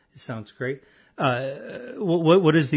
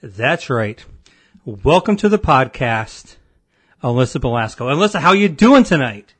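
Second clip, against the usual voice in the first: announcer voice; 6 dB louder than usual. Acted. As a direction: boom it out like a game show host. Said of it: "Oh, that was fantastic".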